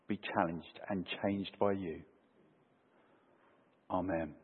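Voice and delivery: hushed, measured prayer with reverent pauses. Real, not acted. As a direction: pray softly, phrase by phrase, settling down to the Amen. Leads the congregation in prayer.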